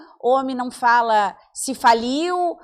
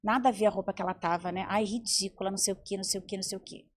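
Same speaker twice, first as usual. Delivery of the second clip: catty gossip; 7 semitones lower, 8 dB quieter, faster than usual. Retold or imitated. Women gossiping once Tupi leaves, low and catty. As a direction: lower and much softer, behind her back. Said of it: "a Tupi sai e a gente fala assim".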